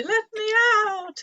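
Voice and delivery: high, drawn-out mock wail; hammed-up plea from a play prisoner; pretend crying. Locked-up grown-up in the children's game, pretend cry. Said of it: "you have to cry every now and then and say".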